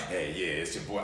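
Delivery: low embarrassed mumble; deflated mumble. Retold or imitated. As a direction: answer grudgingly, deflated. Deflated Aaron reply.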